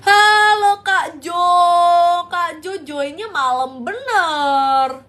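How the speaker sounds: sing-song, slow Balinese lilt; Balinese accent. Shown as a demonstration. Streamer greets viewer Jo in a sung, slow Balinese accent. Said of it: "Kayak accentnya".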